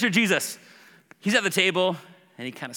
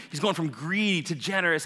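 ordinary talk, nothing marked

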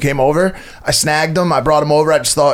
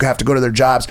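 ordinary delivery

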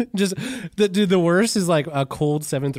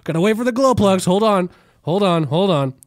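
salesman urging; 6 semitones higher, 6 dB louder than usual. Retold or imitated. Salesman stalling, louder.

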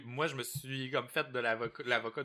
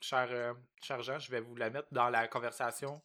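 usual speaking voice

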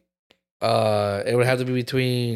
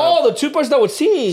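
excited high voice; 14 semitones higher, 4 dB louder than usual. Real, not acted. Excited memory of singing toothbrush.

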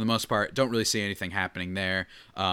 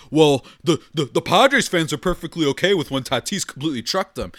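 mocking Dodgers fan; 5 semitones higher, 6 dB louder than usual. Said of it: "they're gonna start freaking out and be like".

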